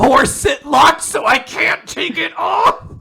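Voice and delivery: high, shouted, hammed-up complaint; mock outraged wail. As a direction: imitate a frantic climax of outrage. Acted Latla's outrage peaks.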